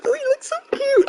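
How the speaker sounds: high squeaky coo over something cute; tiny high-pitched gush; cutesy coo. Real, not acted. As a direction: gush like it's a puppy. Cooing over his painting.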